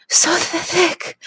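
high, gasping voice; breathless high-pitched exclamation; lustful gasp. Acted. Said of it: "I gasped, lust in my voice".

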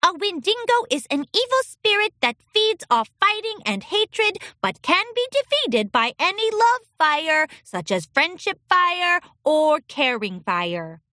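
high-pitched robotic recital; robotic filly. A narrator's reading. Nervous filly answering the princess's question, robotically. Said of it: "She began to speak robotically".

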